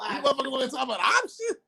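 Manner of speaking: mock outrage. Mock outrage at options talk.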